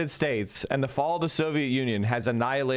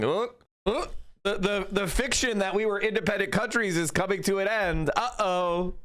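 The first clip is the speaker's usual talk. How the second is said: higher, stammering mock-nervous European voice; nervous gulping; 5 semitones higher than usual. Mocking European politicians, gulping and stammering nervously. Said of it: "the entire European political class is gulping, going".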